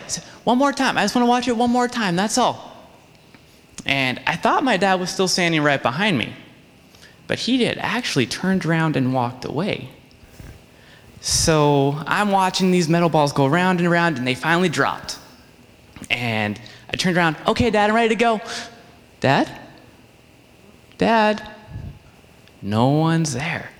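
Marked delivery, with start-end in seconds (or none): 0.4-2.9 put-on high, fast childish begging; pleading kid. Small boy pleading with dad to stay and watch. 17.5-21.4 calling out. Retold or imitated. Lost boy calling out for his missing dad.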